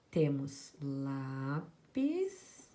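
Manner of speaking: very slow, drawn-out example words; slow dictation. Shown as a demonstration. Teacher dictating examples to the class, very slow. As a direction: stretch each word for the students. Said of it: "dictating example words slowly".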